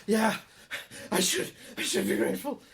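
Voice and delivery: high, fast, voiceless mock gratitude; sarcastic. Mocking the advice to be grateful for anxiety, sarcastic high whisper. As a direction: sneer it out as fake thanks. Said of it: "It's like".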